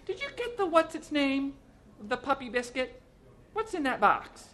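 very high, insistent woman's voice; high-pitched, bossy interrogation; nagging wife. A narrator's reading. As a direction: fire questions at the husband.

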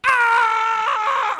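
long, high, loud wail; sustained falling-animal scream; leopard's cry. Acted. Leopard falls into the pit, long loud cry. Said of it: "we all heard him cry out".

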